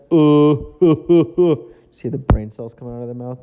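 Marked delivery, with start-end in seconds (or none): 0.1-1.7 loud, vacant uhs; dumb-guy uh. Retold or imitated. Mocking people who talk like that, loud dumb grunts. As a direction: play a brainless guy grunting. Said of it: "people that talk like that".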